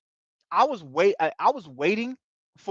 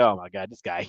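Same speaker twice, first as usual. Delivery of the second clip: much lower, softer, fed-up groan of disbelief; exasperated mutter; 11 semitones lower, 6 dB quieter than usual. Retold or imitated. Jermaine watching Patrick miss, low and exasperated. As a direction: sigh it out, shaking your head. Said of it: "He was like".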